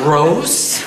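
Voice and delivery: loud, high, laughing yell. A narrator's reading. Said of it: "I yelled".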